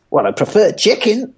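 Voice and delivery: high-pitched comic punchline voice; Cat impression. Cat answering Lister about bad news, high-voiced impression. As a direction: deliver the punchline high and put-on. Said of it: "And Kat says".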